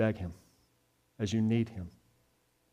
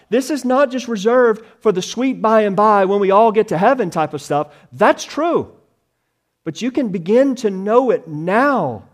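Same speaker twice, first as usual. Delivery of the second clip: loud, high, driving preacher voice; louder and higher, hammering each point; full-force preaching; 8 semitones higher, 9 dB louder than usual. Acted. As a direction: preach it out with fire to the congregation.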